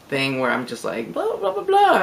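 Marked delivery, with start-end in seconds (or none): 1.1-2.0 a high Boricua babble; playful high gibberish imitating fast Spanish; high-pitched mock Spanish chatter. Shown as a demonstration. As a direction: jump way up high on the blahs. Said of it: "my voice kind of goes up a little bit".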